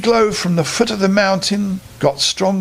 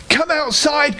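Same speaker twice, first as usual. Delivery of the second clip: calling; 10 semitones higher than usual. A narrator's reading. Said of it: "called Edward".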